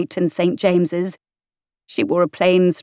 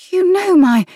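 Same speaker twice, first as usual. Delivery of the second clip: much higher, louder startled cry; startled gasp; 12 semitones higher, 6 dB louder than usual. A narrator's reading. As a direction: break off in shock. Heroine startled, breaks off.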